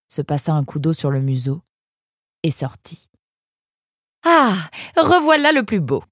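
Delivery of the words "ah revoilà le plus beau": high, loud delighted exclamation; exclaiming mother. A narrator's reading. Mother greeting her son as he reappears, exclaiming. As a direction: call out much higher and louder as a doting mother. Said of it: "s'exclama sa mère".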